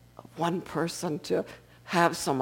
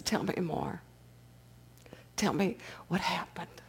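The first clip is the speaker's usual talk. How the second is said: soft, hushed and caring; old man gently coaxing the sobbing drunk; tender whisper; 10 dB quieter than usual. Retold or imitated. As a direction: whisper like soothing a crying child. Old man comforts weeping drunk.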